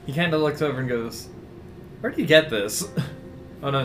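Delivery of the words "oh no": normal talking voice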